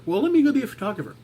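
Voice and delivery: a quick, high burst of mock eagerness; mock eager. Vlogger mimicking his own eager reaction, high and quick. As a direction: tosses off the eager reply to a compliment, high and fast.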